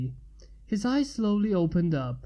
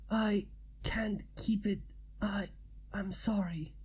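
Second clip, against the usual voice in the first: a softer, fading last breath; a wounded man apologizing as his strength goes, softer and fading; dying, fading; 2 semitones higher, 7 dB quieter than usual. Acted. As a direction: let the voice drain away as he slips.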